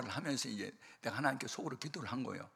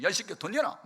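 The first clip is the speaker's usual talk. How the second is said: shouted demand, higher and louder; angry shout; 8 semitones higher, 9 dB louder, faster than usual. Retold or imitated. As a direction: yell it while banging the desk. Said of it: "주먹으로 치면서".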